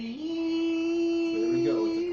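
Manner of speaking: high, slow, drawn-out posh tone; hammed-up fancy announcer; mock classy voice. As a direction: put on airs for the broadcast. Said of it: "we have to act classy".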